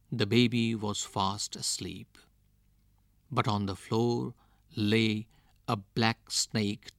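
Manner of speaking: usual speaking voice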